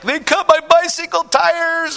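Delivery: high, loud, drawn-out wail of outrage; hysterical kid. Retold or imitated. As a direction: imitate a little boy bawling mad, stretching the words. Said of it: "He's just hysterical".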